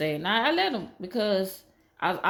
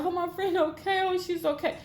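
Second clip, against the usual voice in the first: relieved friend; 11 semitones higher than usual. Retold or imitated. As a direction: sigh it out as the friend.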